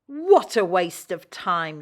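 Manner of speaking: slow, loud scornful sniff; looking down her nose; sniffy. A narrator's reading. Lorry sneering at the engines, slow loud scorn. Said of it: "she sniffed".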